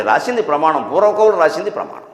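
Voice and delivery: ordinary talk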